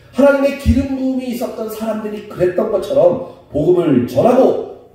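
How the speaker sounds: big, emphatic pulpit delivery; full-force preaching. Real, not acted. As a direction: declare it to the whole congregation, loudly.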